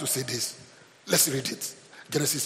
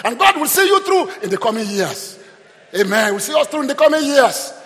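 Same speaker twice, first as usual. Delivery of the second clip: raised, surging encouragement; forceful preaching; 4 semitones higher, 6 dB louder than usual. Real, not acted. Preacher promising God will see you through, forceful preaching. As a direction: lift the congregation with bursts of loud, high voice.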